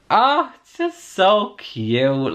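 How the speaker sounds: high, slow swooning gush. Real, not acted. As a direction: melt over how cute it is. Gushing over cute scene.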